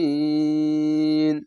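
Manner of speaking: জালিমিন stretched slowly at the stop; madd arid stop. Shown as a demonstration. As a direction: hold the end of জালিমিন three counts. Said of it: "মাদ্দা আরজি হবে".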